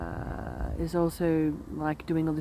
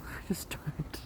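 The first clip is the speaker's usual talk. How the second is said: whispering; 8 dB quieter than usual. Real, not acted. Laughing admission, unvoiced.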